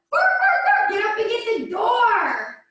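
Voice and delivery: high, loud yappy dog voice; barking dog. Acted. Dog alerting its owner to the doorbell, barking high. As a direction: bark it out high, then urge them on. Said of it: "And your dog's going".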